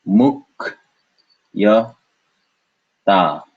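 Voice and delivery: "Muk, ya, ta" with long gaps between syllables. Shown as a demonstration. Teacher splitting for learners, "Muk, ya, ta" in separate beats.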